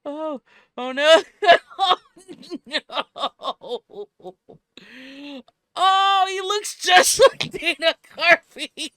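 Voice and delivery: high, loud, breaking with laughter; gasping between laughs; laughing hard. Real, not acted. Peak of laughing fit.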